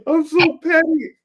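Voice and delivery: laughing. Real, not acted. Laughing at his own pettiness.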